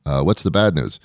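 ordinary delivery

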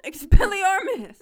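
loud, high shout of a spell; yelled spell. A narrator's reading. Tom Riddle casts a spell mid-duel, yelled. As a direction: shout it like casting a spell, pitch flying up. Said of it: "Tom Riddle yelled".

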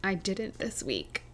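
rough, strained throat; hoarse throat. Real, not acted. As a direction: talk through a sore throat. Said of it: "The hell's wrong with your throat?".

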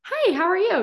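high plain greeting; a bright high ask. Shown as a demonstration. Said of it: "change the inflection in your voice from".

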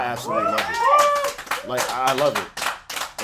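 high, loud burst of joy; excited. Real, not acted. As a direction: shout the love, then draw it out. Joyful outburst in speech.

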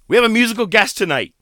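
loud, high, quick announcement; ring announcer. Retold or imitated. Promoter telling the crowd about the guest, hyped. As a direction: imitate a hyped-up emcee.

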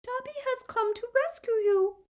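very high little house-elf voice; small, high-pitched, earnest creature; tiny squeak. A narrator's reading. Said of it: "he squeaked in the tiniest quiver of a voice".

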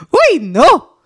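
high, loud shiver of dread; mock shudder. Real, not acted. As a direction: recoil in playful fright. Shuddering at the idea.

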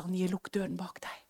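breathy whisper, a hint of a divine voice; God's whispered command. God whispering to Anie to shut the door. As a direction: whisper the Lord's command gently. God speaking to her, retold.

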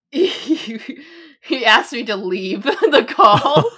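loud, slowed by laughter; laughing through words. Real, not acted. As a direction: struggle to get it out while laughing. Laughs recalling being told to leave.

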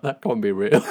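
laughing. Real, not acted. Laughing while retelling.

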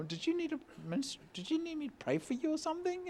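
flustered preacher fumbling an offer of prayer; nervous squeaky offer. Retold or imitated.

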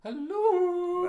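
very high, loud cooed hello; hammed-up mimicry of a greeting; exaggerated impression. Co-host tops the other's impression of host, exaggerated mimicry. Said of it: "It's more like".

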